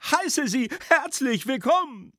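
high, loud piping youth; heckler's voice. A narrator's reading. Drunk schoolboy mockingly welcomes His Excellency, high heckling. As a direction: greet him in a high put-on voice. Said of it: "schrie die Stimme abermals".

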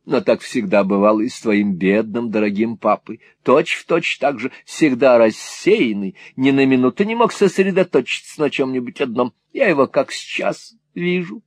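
lightly dramatised Mrs Nickleby; exclaiming, wistful mother. Acted. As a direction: start exclaiming, ease into fond lament.

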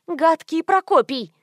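high, drawn-out rebuke; indignant scolding. A narrator's reading. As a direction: call the animal names in annoyance. Heroine scolds the donkey.

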